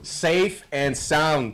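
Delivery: slow. Real, not acted. Savouring a repeated phrase.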